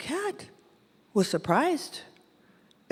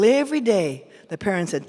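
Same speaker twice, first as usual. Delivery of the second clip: usual speaking voice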